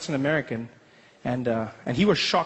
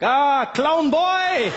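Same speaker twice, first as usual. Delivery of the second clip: high, slow, loud German welcome; German dad greeting; 11 semitones higher, 4 dB louder, much slower than usual. Retold or imitated. Father greets Trevor at the gate, slow German boom. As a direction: drag it out with mock delight.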